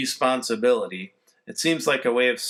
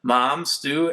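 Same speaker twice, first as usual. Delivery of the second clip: usual speaking voice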